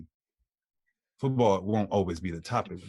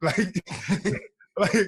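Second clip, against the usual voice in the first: ordinary delivery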